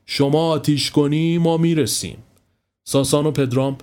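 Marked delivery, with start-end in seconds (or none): none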